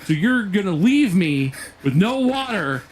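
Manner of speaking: slow, incredulous build to a strained outburst; re-enacted fuming customer; livid. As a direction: spell it out in outrage, voice breaking up at the end. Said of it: "I'm livid at this point".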